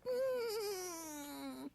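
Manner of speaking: ordinary talk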